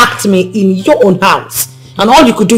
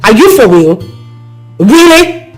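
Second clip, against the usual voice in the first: angry rebuke. Acted. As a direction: tear into him for doing nothing. Woman berating the apologizing man.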